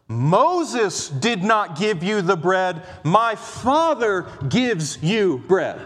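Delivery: "Moses" and "my Father" slow and weighted; stress on Moses and Father. Shown as a demonstration. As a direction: lean on the names to set them against each other. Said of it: "the emphasis ... could be on Moses and my Father".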